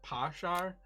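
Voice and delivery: "parshar" in an Irish accent, each word squeezed to one syllable; clipped Irish "parshar". Shown as a demonstration.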